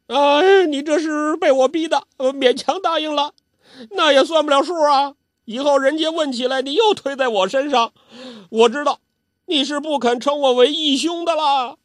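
put-on high, loud, childish old man; whiny old urchin pressing his sworn-brother claim; Zhou Botong pestering. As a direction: nag like an aggrieved old brat. Zhou Botong pesters Guo Jing.

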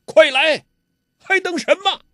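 high, loud villain's roar; loud shout. A narrator's reading. Ouyang Feng shouting at the girl to hurry, high and loud. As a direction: bellow at them to hurry. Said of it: "欧阳锋大喝".

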